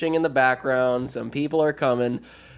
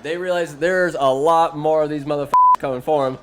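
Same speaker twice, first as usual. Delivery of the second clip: normal talking voice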